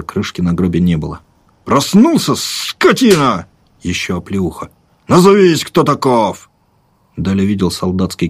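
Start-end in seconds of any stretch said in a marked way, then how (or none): 1.6-3.5 high barked order, slow on скотина; rough soldier shout. Acted. Soldier mocks waking man. 5.1-6.5 high, loud barked command; soldier's shouted demand. Acted.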